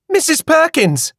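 high, urgent call across the shop. A narrator's reading. Woman rushing in calls the shopkeeper urgently.

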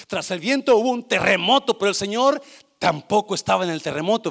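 building loud. Acted. Preacher building the earthquake.

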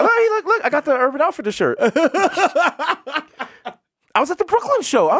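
high excited look look; excited roach fan. Retold or imitated. Roach meeting a comedian fan, high excited squeal. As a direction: squeal like a star-struck fan. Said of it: "He's like".

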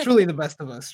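booming, grand praise; loud mock praise. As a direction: proclaim it like a toast. Over-the-top praise of guest.

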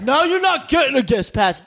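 high-pitched mock-angry refusal; mad. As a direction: imitate the manager's indignant ruling. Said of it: "he got so mad".

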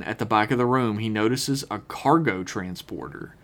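normal talking voice

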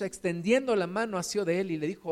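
usual speaking voice

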